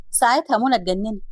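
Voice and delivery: ordinary delivery, nothing marked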